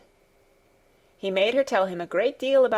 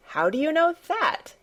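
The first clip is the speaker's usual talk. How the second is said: high-pitched, surprised question; surprised demand; 8 semitones higher than usual. A narrator's reading. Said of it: "he demanded in a surprised voice".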